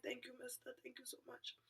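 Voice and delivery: very soft, near-whispered thanks; hushed gratitude. Retold or imitated. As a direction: thank him faintly, voice almost gone. Acts out thanking the cab driver.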